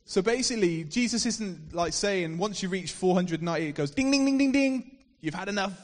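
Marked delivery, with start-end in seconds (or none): 3.7-5.0 bell ding. Pretend. Mock counter bell for forgiveness.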